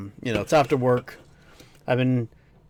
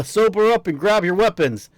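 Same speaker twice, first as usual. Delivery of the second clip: rallying order; 6 semitones higher, 4 dB louder than usual. Acted. Voicing a village warrior's order.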